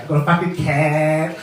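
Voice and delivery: emphatic mock confession. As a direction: push it out and stretch CAT. Confession repeated with rising force.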